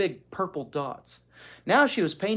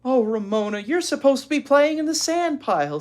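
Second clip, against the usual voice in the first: higher, louder scolding sister; big sister scolds Ramona, higher and louder; exasperated big sister; 12 semitones higher, 8 dB louder than usual. Acted. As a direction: chide the little sister. Beezus scolds little sister.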